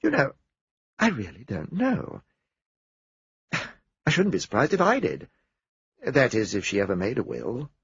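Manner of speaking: mildly taken aback; understated surprise; mildly surprised. Acted. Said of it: "voice sounded mildly surprised".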